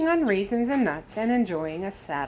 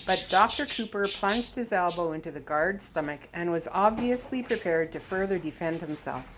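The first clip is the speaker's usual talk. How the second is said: ordinary delivery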